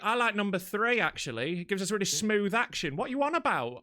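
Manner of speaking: high-pitched mock-indignant rant; sarcastic incredulity. Driver mocks queue-jumper's excuse, sarcastic and exasperated. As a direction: re-enact scornful disbelief, pitch jumping up. Acting out sarcastic reply in row.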